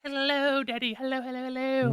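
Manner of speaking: very high, squeaky cartoon voice; high-pitched imitation of a cartoon greeting; cartoon character imitation. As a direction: chirp it like a tiny cartoon creature. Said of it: "same voice actor 'cause he goes".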